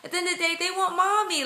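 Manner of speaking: high-pitched, delighted argument; excited gushing. Retold or imitated. Mother-to-be imagining her son choosing mommy, excited gushing.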